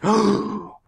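sharp high mock gasp of shock. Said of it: "everybody was like".

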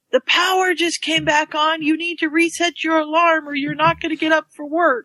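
high-pitched mock yell of a mother through the door; mom yelling. Daughter imitating her mom yelling through the door at midnight, high mock yell. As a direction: call it out like mom waking you at midnight. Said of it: "my mom's yelling".

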